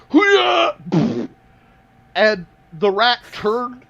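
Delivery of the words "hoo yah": high, loud battle cry; karate yell. Acted. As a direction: yell it as you swing. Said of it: "you just, like".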